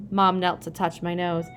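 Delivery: plain reading voice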